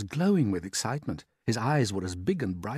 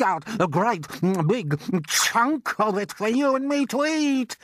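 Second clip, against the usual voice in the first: a far higher, louder aunt's shout; Aunt Spiker shouting; 12 semitones higher, 7 dB louder than usual. Acted. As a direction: screech it out as the nasty aunt. Aunt voiced in story.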